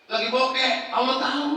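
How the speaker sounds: bokeh cried out high and loud; loud preaching emphasis. Shown as a demonstration. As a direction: ring it out over the congregation. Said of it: "repeated louder".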